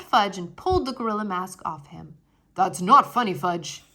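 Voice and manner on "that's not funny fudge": loud stern rebuke; firm loud scolding; scolding father. Acted. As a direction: stop the child's prank.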